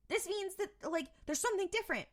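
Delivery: high-pitched, eager insistence; retold excited realization. Sam realizes the loop has changed, excited. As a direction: imitate someone excitedly working it out.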